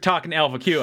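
normal talking voice

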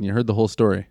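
normal talking voice

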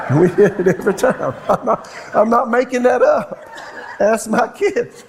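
high, laughing protest; laughing insistence. Real, not acted. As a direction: insist through laughter that it's true. Laughs insisting story is true.

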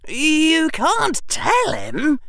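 urgent, frightened plea; alarmed. Acted. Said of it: "his voice rising in alarm".

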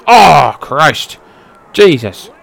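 sudden loud wince; shocked outburst. Real, not acted. Viewer shocked by a brutal hit, loud outburst. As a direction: react like you just saw it hurt.

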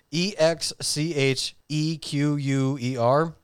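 slow letter-by-letter spelling. Real, not acted. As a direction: spell it slowly, each letter separated. Spelling out a hard word.

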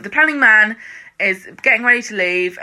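ordinary talk, nothing marked

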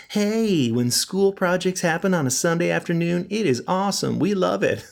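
high, sing-song fake enthusiasm; mock cheery. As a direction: gush with sarcastic cheer. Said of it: "I'm not trying to make it sound like".

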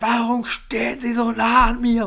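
mocking an aggressive man shouting; imitated aggressive yell. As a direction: squawk it like an angry stranger in your face. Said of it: "während er mich mega aggressiv angeschrien hat".

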